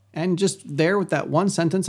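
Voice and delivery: ordinary talk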